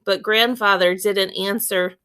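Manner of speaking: ordinary delivery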